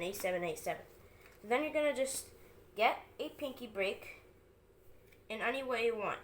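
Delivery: ordinary delivery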